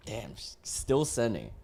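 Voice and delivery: normal talking voice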